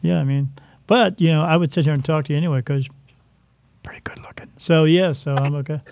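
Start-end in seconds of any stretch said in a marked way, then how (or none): 3.8-4.5 whispered aside. Real, not acted. Flirty aside to the host.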